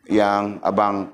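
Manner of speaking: usual speaking voice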